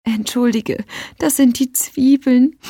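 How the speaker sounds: covering up crying with a weak excuse; sniffling. A narrator's reading. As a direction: sniff through a fake onion excuse. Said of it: "schniefte sie".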